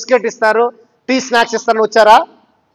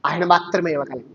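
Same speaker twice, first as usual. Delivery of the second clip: normal talking voice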